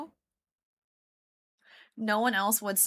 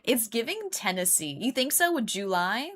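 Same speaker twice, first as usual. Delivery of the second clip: ordinary talk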